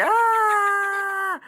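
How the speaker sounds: high fleeing raptor scream. Acted. Raptor running off screaming and crying, high and loud. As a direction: wail it high as he runs. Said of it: "ran off screaming and crying".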